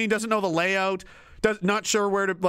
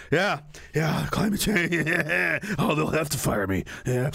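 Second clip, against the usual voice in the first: lightly played drunk muttering to himself; drunk despairing politician; 3 semitones lower than usual. Leader at home pounding rum and plotting, mock drunk. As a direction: stop and start in broken, boozy bits. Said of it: "he's just at home, like, pounding rum".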